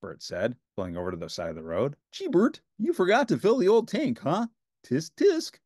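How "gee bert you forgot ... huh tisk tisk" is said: high, bright Muppet Ernie voice; cheerful Ernie, pitched well up. A narrator's reading. Ernie answering Bert, in a high cheerful voice.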